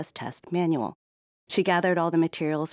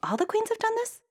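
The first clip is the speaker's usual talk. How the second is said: very high, astonished question; disbelieving princess. Acted. Princess voice in story.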